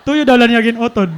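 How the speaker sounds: can barely get it out for laughing. Real, not acted. Telling her sibling the punchline, laughing hard.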